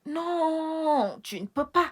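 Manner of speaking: high, drawn-out whining no; dismayed high-pitched plea; pleading protest. Acted. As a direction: beg him not to go, pitched high. Begs husband not to leave.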